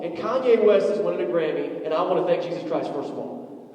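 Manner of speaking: ordinary talk, nothing marked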